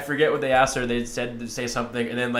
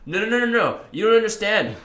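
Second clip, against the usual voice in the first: high, louder imitated objector; mock stubborn denial; 9 semitones higher, 5 dB louder than usual. Imitating a viewer who ignores the debunking, high mock denial. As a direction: protest over the evidence in a high put-on voice.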